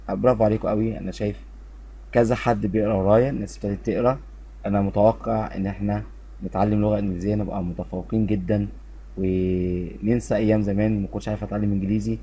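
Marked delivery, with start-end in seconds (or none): none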